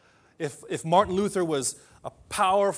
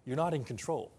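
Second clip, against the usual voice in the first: calm reassurance; 3 semitones lower, 5 dB quieter than usual. Retold or imitated. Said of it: "Martin Luther was saying".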